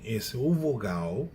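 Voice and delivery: normal talking voice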